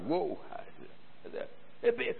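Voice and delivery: ordinary talk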